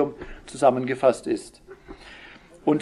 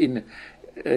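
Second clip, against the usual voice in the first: normal talking voice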